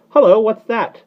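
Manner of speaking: high, loud exclamation; startled high call. A narrator's reading. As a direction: pipe up in sudden surprise. Startled bird hears noise.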